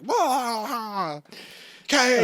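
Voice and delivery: put-on high whiny crying kid wail over cake; mock kid wailing. Kids whining in retold scene.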